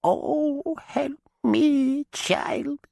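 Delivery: very high, thin pleading; begging dead witch. A narrator's reading. Chained witch begs Alice, high and pleading. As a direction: beg the child for help. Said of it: "Maggie begged".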